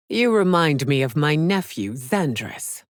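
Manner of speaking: ordinary talk, nothing marked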